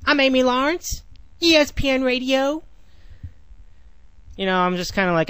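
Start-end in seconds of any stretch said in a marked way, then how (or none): 0.1-2.6 higher, put-on radio host voice; mock radio host introduction, pitched up; Amy Lawrence radio intro. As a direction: ham up a female sports radio host's sign-on. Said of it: "when she was on".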